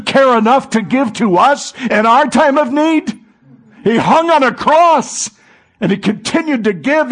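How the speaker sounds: loud, high, fervent sermon climb; preaching at full force. Acted. Preacher to congregation on Jesus giving, loud fervent preaching. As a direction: preach it out, rising to a cry.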